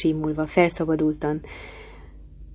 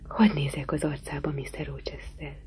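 whisper; 8 dB quieter than usual. Acted. Jane whispers in moonlight.

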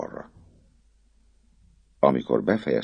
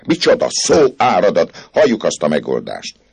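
much higher, louder impatient snap; impatient outburst; 9 semitones higher, 10 dB louder than usual. A narrator's reading. Priest loses patience with chattering poet, loud impatient burst. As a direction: cut him off, out of patience. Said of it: "A pap türelmetlenségében".

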